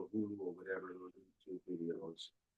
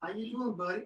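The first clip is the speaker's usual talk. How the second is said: much higher, louder greeting to a pet; imitated dog-talk; 14 semitones higher, 7 dB louder than usual. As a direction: mimic grandkids cooing at the dog. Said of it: "they start talking to him like that".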